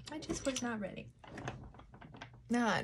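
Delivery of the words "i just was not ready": quiet. Real, not acted.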